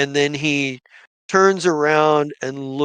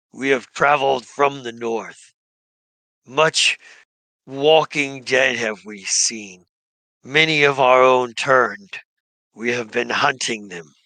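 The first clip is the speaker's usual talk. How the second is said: lightly voiced gnoll shaman; 3 semitones lower than usual. Gnoll shaman thanks the party for killing undead, lightly voiced and solemn.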